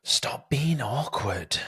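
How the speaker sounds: whispered to himself; a real whisper to himself; whispered self-scold. Real, not acted. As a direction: hush yourself, stop being awkward. Scolding himself under his breath.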